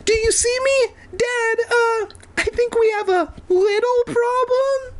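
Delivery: very high squeaky calling; squeaky mouse voice. Acted. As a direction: call up like a tiny mouse. Mouse Evan calls to dad.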